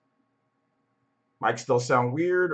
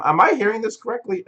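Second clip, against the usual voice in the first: incredulous; 9 semitones higher, 5 dB louder than usual. Real, not acted. Disbelief at viewers hating music.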